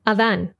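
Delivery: Adán with open a's, stressed at the end; Spanish pronunciation. Shown as a demonstration. Teacher contrasting names between languages, Spanish Adán. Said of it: "I'll read the Spanish pronunciation first".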